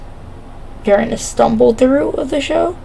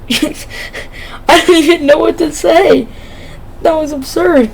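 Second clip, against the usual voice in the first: laughing; 6 semitones higher than usual. Real, not acted. Stunned by the teammate sell, laughing. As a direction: talk through real laughter. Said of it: "I need to stop laughing".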